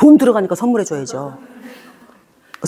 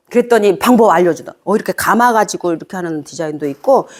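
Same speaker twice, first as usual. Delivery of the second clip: ordinary talk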